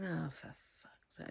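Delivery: low, soft muttered curse; muttered exasperation. Retold or imitated. Retelling her reaction to no bath, a muttered curse. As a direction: mutter it under your breath, fed up.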